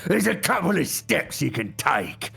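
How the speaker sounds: loud, slow orc growl; drawn-out, growled orc speech. Shown as a demonstration. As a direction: talk like a big growling orc. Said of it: "do an orc voice".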